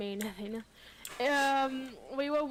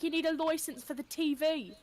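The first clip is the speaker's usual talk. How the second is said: mock British official; exaggerated British nagging; British accent; 3 semitones higher than usual. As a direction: put on British for the joke. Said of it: "British people be like".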